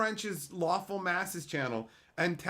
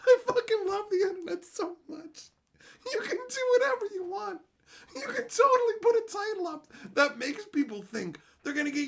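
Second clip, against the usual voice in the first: high cracking laugh-cry; laughing through words; 4 dB louder than usual. Real, not acted. Loving the internet, high laugh-cracked voice.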